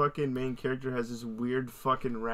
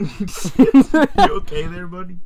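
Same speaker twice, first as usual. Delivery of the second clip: loud, slow laughing check-in; laughing tease; 5 semitones higher, 13 dB louder, much slower than usual. Real, not acted. Teasing his friend after the outburst, laughing slowly. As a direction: laugh through a teasing question.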